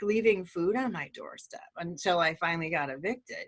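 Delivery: normal talking voice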